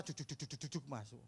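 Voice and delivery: soft rhythmic juk juk like a train; train chugging sound. Shown as a demonstration. Preacher imitating a train for the congregation, softly chugging. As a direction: chug it out quietly, like a little train. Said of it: "Suara kereta".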